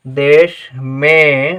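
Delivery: slow dictation. Shown as a demonstration. Teacher dictating words slowly.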